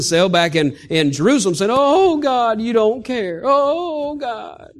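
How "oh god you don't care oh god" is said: high, wailing "oh God" lament; a hammed-up prisoner's whine, pitched high; self-pitying complaint. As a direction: moan to God like you've been abandoned.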